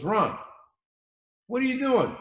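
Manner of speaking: the owner catching him out, voice jumping up in surprise; surprised boss. Retold or imitated.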